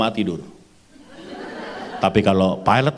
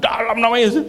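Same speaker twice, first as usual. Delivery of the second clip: high-pitched, urgent prayer cry; shouted prayer; 12 semitones higher than usual. Retold or imitated. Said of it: "Begitu saya doakan". (seruan doa bernada tinggi)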